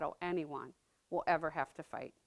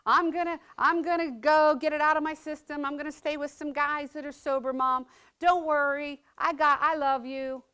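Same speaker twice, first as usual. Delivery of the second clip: a hint of cocky, overconfident son, higher and louder; lightly voiced brush-off; son's addiction talking; 7 semitones higher, 8 dB louder than usual. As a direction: son talking over the disease, mildly overconfident. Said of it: "I could hear it in his voice".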